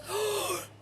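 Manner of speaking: sharp high gasp; quick startled intake of breath; retold gasp. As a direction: gasp in mock shock. Star hears of medical procedure.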